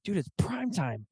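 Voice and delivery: high, hushed mock protest; cameraman's protest. Cameraman objecting to leaving the hunt, mock protest. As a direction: draw it out softly in high-pitched disbelief.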